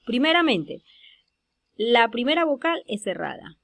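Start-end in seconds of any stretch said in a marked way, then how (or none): none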